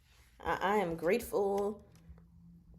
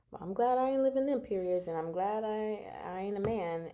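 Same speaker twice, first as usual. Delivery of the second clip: ordinary talk, nothing marked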